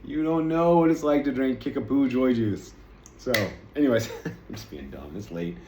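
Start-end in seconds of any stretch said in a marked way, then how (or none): 0.0-2.7 slow, louder, lightly hammed-up scolding; mock superior boast. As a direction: tease a friend, slowly, as a know-it-all. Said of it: "You'd be like".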